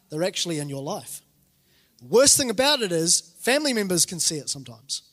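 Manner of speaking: normal talking voice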